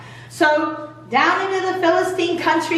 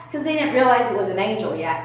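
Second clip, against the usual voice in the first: ordinary talk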